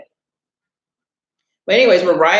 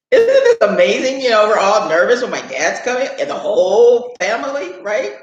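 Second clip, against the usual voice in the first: laughing; 5 semitones higher than usual. Real, not acted. Laughing, amused by memory.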